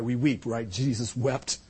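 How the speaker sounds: ordinary talk, nothing marked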